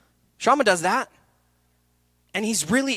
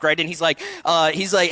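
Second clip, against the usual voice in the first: ordinary talk, nothing marked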